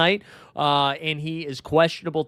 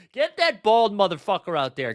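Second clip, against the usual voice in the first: usual speaking voice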